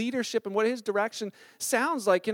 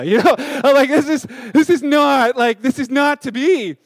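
laughing protest; 3 semitones higher, 10 dB louder than usual. Real, not acted. Laughing denial of calling.

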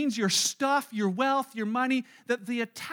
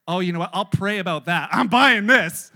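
cheeky shopper overruling the conscience; defiant splurge; 4 semitones lower, 10 dB louder than usual. Acted.

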